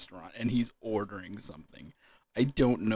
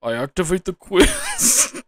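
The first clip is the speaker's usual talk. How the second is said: higher and louder, drawn out, declaring a move; Yugi voice, bigger; 6 semitones higher, 8 dB louder, slower than usual. Pretend. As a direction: announce it like a duelist at full power. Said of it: "can't help it".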